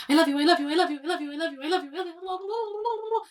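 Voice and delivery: high, rapid, strange chant of I love you; loud weird babble fading to blah blah; weird repetition. Retold or imitated. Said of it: "it got really weird".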